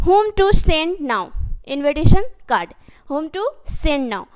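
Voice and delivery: very slow, word by word; slow, careful model sentence; slow dictation. Shown as a demonstration. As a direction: spell it out slowly for the class. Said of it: "teacher models the English sentence".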